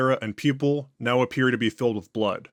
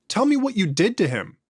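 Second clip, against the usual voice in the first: high, forceful demand; angry demand; 9 semitones higher, 3 dB louder than usual. Acted. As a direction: press the creature for answers. Interrogator demands answer angrily.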